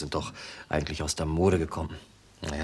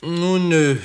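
a sudden, higher, louder start; startled; 11 semitones higher, 8 dB louder than usual. Acted. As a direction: blurt it out, caught off guard. Traps startled by question. (überrascht aufgefahren)

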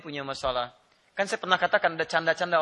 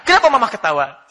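mock angry husband; 8 semitones higher, 14 dB louder than usual. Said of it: "Suami marah-marah".

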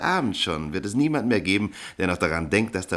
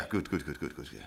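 normal talking voice

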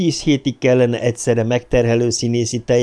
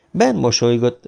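ordinary delivery

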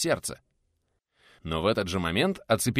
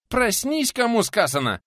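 very high, loud command; high, strained urgent yell; urgent wake-up shout; 12 semitones higher, 5 dB louder than usual. A narrator's reading. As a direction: shout to jolt someone awake. Guest shouting to wake his attacker.

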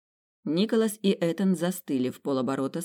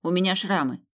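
rude retort; 2 semitones higher, 3 dB louder than usual. Acted. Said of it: "довольно грубо отбрила".

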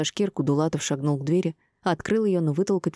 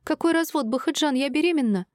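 very high, agitated outburst; upset wife blurting her news; 10 semitones higher than usual. A narrator's reading. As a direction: protest in high-pitched alarm. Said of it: "выпалила, прижав ладонь к животу".

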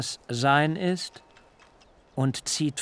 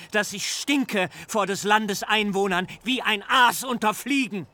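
put-on furious old father; 7 semitones higher than usual. A narrator's reading. Jakob berates his sons for the bloodshed, high and anguished. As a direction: cry out at them in despair.

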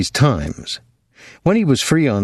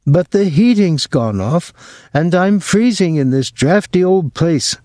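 put-on higher, martyred old mother voice; complaining mother; 8 semitones higher than usual. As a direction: play the put-upon mother.

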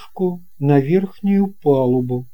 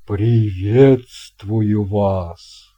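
low, loud, booming sea captain; a hearty old captain welcoming a guest; captain's voice; 4 semitones lower, 5 dB louder, much slower than usual. Acted. Captain greets boy. (басовитый голос капитана)

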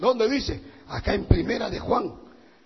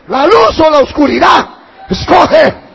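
very loud preaching shout; hammering each choice loudly; thundering ultimatum; 11 dB louder than usual. Real, not acted. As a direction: demand a decision at the top of your voice.

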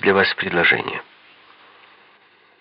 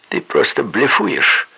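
defiant; 4 semitones higher, slower than usual. A narrator's reading. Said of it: "с вызовом бросил Мэддокс".

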